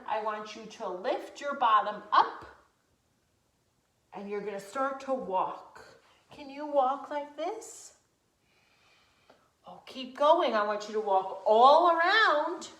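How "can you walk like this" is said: normal talking voice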